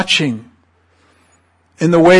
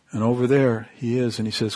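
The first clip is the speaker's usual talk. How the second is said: ordinary talk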